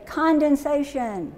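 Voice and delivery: slow, high, stretched-out word; call-out for repeating. Shown as a demonstration. Teacher has class say the spelling word, high and drawn out. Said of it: "and say".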